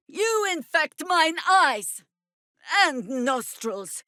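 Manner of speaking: high, slow disgusted cry; disgusted outburst. Acted. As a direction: recoil in revulsion, drawn out.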